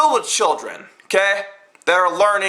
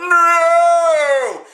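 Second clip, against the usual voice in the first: sudden loud high "No!"; Vader's cry of despair, hammed up; mock Vader no; 7 semitones higher, 5 dB louder than usual. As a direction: belt out a hammed-up movie "No!". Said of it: "add Darth Vader going".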